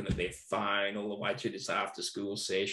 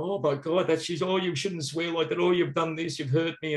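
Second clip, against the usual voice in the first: higher, hammed-up hurt whine; mock wounded teacher; 6 semitones higher, 3 dB louder than usual. Mocking how another teacher would react to the swearing. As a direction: play the offended teacher for laughs. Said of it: "another situation might go".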